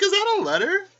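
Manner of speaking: high, loud sudden stop; startled double take. Retold or imitated. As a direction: freeze mid-thought, loud and high. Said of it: "I was like".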